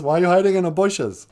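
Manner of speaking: high, loud questioning; acted puzzled demand. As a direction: question someone who is hiding. God questions hidden Adam.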